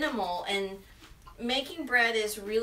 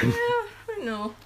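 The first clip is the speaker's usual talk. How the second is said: laughing; 10 semitones higher, slower than usual. Real, not acted. Laughing after burn.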